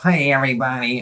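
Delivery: Poindexter. Acted. Host mocking the glasses-pushing Poindexter type, nerdy voice. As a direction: greet them like an eager nerd. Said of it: "the Poindexter that's pushing her glasses up".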